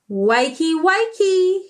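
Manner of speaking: lightly voiced villain calling wakey. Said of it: "he called".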